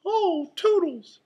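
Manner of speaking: very high, loud cartoon mouse voice; Mickey. A narrator's reading. Mouse cheering up his friend, high cheery squeak. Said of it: "Mickey said".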